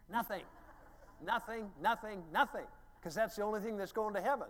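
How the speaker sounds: high, loud preaching climax; preaching shout. Real, not acted. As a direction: hammer it home to the congregation.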